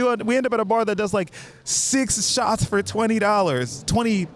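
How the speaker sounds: high, rolling hype; excited storytelling. Real, not acted. Telling about bar hopping with two randos, excited. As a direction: keep the pitch up and ride the repetition.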